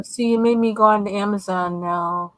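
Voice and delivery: normal talking voice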